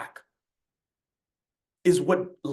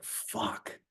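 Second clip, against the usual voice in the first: whispered curse; 11 dB quieter than usual. Retold or imitated. Shocked reaction to trainer's question.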